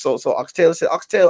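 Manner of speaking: usual speaking voice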